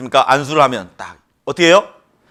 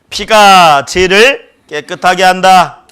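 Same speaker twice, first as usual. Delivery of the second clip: 피가 죄를 깨끗하게 한다 said slowly, stress on 피가; slow repeat-after-me; 4 semitones higher, 6 dB louder, much slower than usual. Shown as a demonstration. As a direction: lead the congregation word by word. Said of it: "따라해보죠". (천천히 따라 하게 하는 선창)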